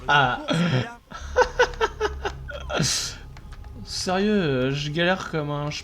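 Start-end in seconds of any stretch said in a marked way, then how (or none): 0.5-3.8 high breathy laugh. Real, not acted. Rire de soulagement.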